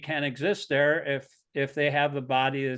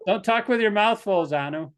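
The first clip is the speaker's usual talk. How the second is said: laughing; 7 semitones higher, 5 dB louder than usual. Real, not acted.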